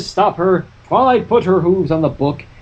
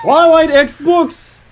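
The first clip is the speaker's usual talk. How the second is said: mock grand announcement; 10 semitones higher, 4 dB louder, slower than usual. Announcing a ship.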